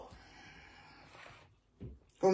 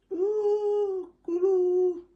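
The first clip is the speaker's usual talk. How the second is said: much higher, falsetto coo; teasing high-pitched call; playful cooing; 12 semitones higher, much slower than usual. Real, not acted. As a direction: coo it up high like a little bird.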